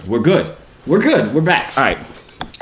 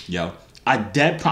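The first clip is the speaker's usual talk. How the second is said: ordinary delivery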